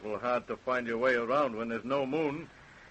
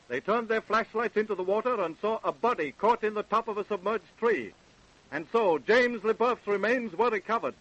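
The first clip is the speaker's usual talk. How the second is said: higher, louder storyteller's voice; radio narration; 10 semitones higher, 5 dB louder than usual. A narrator's reading. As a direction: narrate the aftermath like an announcer. Narrator bridges the scenes.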